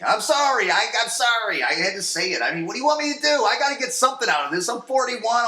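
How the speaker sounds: loud, high-pitched self-justifying rant; mock-defensive outburst. Real, not acted. Podcaster defending his naughty joke to listeners, mock-defensive.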